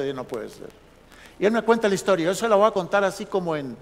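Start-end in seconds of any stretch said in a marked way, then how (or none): 0.1-0.7 soft, low, stunned murmur; hushed low amazement; disbelieving under breath. Retold or imitated. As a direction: let it fall away in quiet disbelief. Pastor's retold disbelief again.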